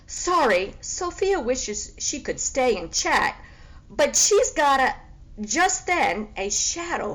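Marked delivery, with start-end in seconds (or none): 0.1-4.9 loud, airy excuse; breezy brush-off. Acted. Sophia brushes off the pig with a grand, airy excuse. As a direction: wave them away grandly.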